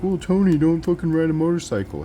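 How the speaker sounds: high, loud mock objection; mocking a critic. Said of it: "And you're like".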